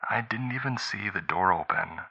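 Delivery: hushed fear; whisper. A narrator's reading. Tanya hiding from the intruder, whisper. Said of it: "Tanya whispered".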